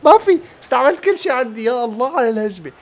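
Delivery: very high, loud wail easing down; anguished outcry. Real, not acted. Gamer who used up everything, loud anguished cry. Gamer cries out as attack fails.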